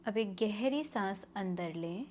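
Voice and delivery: slow, measured meditation voice with long pauses; guided meditation. Acted. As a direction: guide them through each breath, drawing the words out. Leads a guided breathing meditation.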